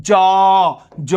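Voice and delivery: জ held long as an open "jooo"; sounded vowel. Shown as a demonstration. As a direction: draw out the o in জ. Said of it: "জয়ের সাথে অটা কি হয়? উচ্চারিত হচ্ছে".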